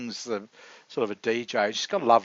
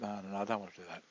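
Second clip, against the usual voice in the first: hurried soft refusal; 3 semitones lower, 9 dB quieter, faster than usual. Retold or imitated. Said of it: "She said".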